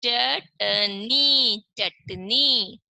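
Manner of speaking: Chutney drawn out very slowly and clearly, said twice; slow teacher demo word; very slow model reading. Shown as a demonstration. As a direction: stretch chutney out for the class to copy. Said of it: "तो क्या पढ़ेंगे इसे?".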